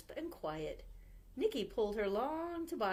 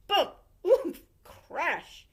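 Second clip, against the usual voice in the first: sound effects; 9 semitones higher, 10 dB louder, much slower than usual. A narrator's reading.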